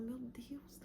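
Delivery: softly whispered plea; a hushed retold prayer; whispered cry. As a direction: whisper it to God.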